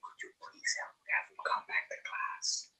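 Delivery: hushed, voiceless whisper of a nervous new teacher; breathless whispered request; whispered plea. Retold or imitated. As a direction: whisper it like you're asking for help quietly. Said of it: "And she goes".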